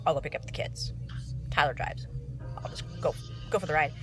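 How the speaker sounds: soft unvoiced whisper; whispered aside. Real, not acted.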